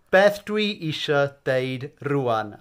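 "Beth dwi isio deud rwan" said slowly, each word clear; slow model phrase. Shown as a demonstration. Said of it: "little wobble in the core there".

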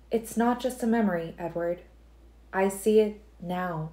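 Alice telling Edward she sees it now; Alice, insisting. A narrator's reading. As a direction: lift into Alice and press the point.

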